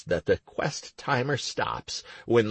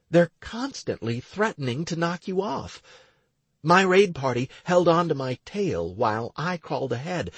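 lightly raised woman's voice; young woman voice; 8 semitones higher than usual. Acted. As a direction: voice Stacy a little higher. Stacy explains the tomb.

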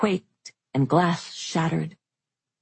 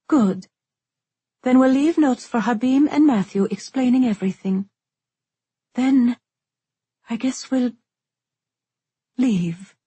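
put-on young woman voice; 7 semitones higher than usual. A narrator's reading. Isolde voiced in narration.

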